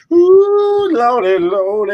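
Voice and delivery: high, slow mock wail of ooh lordy; hammed-up lament. Teller imagining himself as a slave, high drawn-out mock wail. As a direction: wail it high and slow like an old plantation hand.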